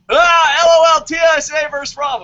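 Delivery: hammed-up yell of the comment; mock shouted comment. Mocking a viewer's comment with a hammed-up yell. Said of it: "it's like".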